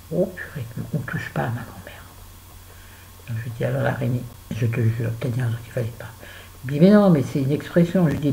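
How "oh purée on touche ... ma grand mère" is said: hushed, indignant whisper; whispered outrage. Real, not acted. Mother telling viewers, whispered indignation. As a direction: breathe it out, offended.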